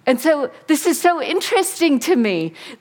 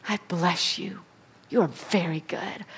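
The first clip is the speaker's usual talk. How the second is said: soft whispered blessing; 4 semitones lower, 9 dB quieter than usual. Acted. God blessing his people, whispered. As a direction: whisper it tenderly.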